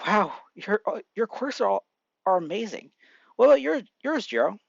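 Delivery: mildly awed, pitch lifting; starry-eyed wonder. A narrator's reading. New student admiring classmates' quirks, wide-eyed wonder. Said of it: "eyes sparkling as he says".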